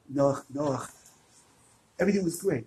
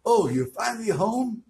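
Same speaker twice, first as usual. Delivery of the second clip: scolding wife; 8 semitones higher, 5 dB louder than usual. Acted. Wife scolding husband at door.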